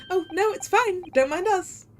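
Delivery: high, lilting reassurance; high-pitched, placating; breezy reassurance. Acted. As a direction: wave it off in a high voice. Detective placates suspicious cabin occupant.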